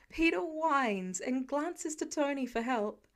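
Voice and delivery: ordinary delivery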